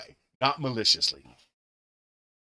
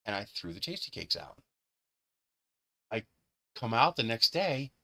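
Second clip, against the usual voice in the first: ordinary talk, nothing marked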